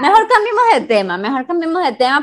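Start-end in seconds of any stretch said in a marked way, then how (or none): 0.0-1.2 fast, higher, brushing it off; quick high switch. Acted. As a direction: hurry to drop the subject. Jokingly ending the touchy topic.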